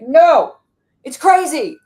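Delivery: high, loud panicked protest; panicked manager part. Acted. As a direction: cry out like a frantic inner manager. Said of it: "Managers are like".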